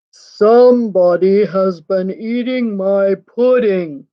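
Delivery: slow, booming Papa Bear; big bear voice, each word drawn out slowly; great big voice. A narrator's reading. Papa Bear finds his pudding eaten, great big voice. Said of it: "in his great big voice".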